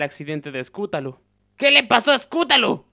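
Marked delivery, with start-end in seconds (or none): 1.6-2.8 high, raised-voice demand; insistent demand. Acted. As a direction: burst out with the question, impatient for an answer. Asker insists after repeated questions.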